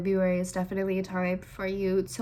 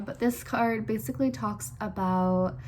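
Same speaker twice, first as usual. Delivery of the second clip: usual speaking voice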